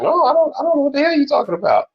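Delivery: high, fast mock denial; playing dumb. Denying he understood the women, high fast mock voice. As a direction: feign total confusion. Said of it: "I'll be like".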